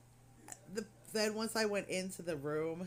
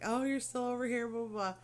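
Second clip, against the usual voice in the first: teasing voice; 6 semitones higher, 5 dB louder than usual. Retold or imitated. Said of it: "saying stuff like".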